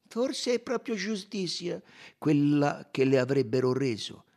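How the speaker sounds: high, measured rejoinder; Poirot, pointed. A narrator's reading.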